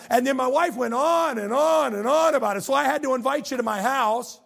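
loud, high, sarcastic complaining; exasperated rant. Acted. Peter griping to Jesus about wife and mother-in-law, exasperated.

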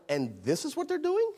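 incredulous question swooping up at the end; incredulous God. Acted. God seeing what the united people do, incredulous. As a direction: ask it in disbelief, voice climbing. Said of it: "the voice of the Lord saying".

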